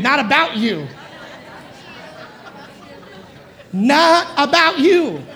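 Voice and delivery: sassy, drawn-out and laughing; with attitude. Shown as a demonstration. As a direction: give it some attitude. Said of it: "say it with attitude".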